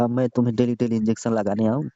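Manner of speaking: usual speaking voice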